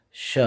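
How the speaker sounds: SH said alone as a short "shuh"; SH sound. Shown as a demonstration. Teacher demonstrates the word's first sound, SH. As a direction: give just the opening sh sound. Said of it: "is pronounced as".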